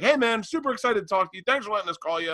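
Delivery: high, quick friendly host voice; friendly radio host. Retold or imitated. Radio host thanking the comedian for the call, high and chirpy. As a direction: lift the pitch, gush a little.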